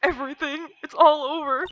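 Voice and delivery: high, overwrought cry of doom; hammed-up hysterical client; despairing screaming. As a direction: wail it out in mock despair. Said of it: "Isn't that your client screaming over there?".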